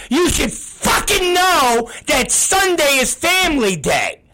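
high, heated scolding; angry rebuke. Real, not acted. Boss scolding employees about Sunday family day, angry. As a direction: drive it home in real anger.

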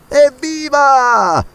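high, long drawn-out cheer. Acted. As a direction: cheer the escape. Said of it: "gridarono i due compagni". (i due compagni esultano, grido lungo)

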